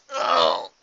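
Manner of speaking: loud low grunt of pain. Acted. Huey hit by brain freeze, loud pained grunt. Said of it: "grunted Huey".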